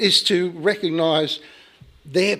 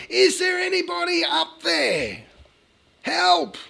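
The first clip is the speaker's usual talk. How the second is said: very high, drawn-out call; acted shout for help; 14 semitones higher than usual. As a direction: shout up from a cliff branch. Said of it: "He shouted again".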